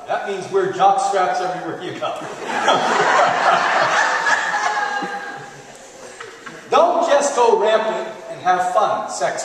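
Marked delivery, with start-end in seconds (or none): 2.2-6.6 long airy laughing fit; real laugh, loud and airy; real laughter. Real, not acted. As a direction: laugh hard at the joke. Laughs at own joke.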